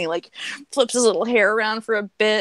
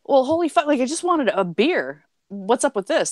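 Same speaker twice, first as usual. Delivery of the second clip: shocked trucker; 7 semitones higher than usual. Retold or imitated.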